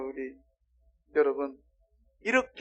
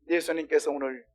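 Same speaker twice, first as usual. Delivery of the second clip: normal talking voice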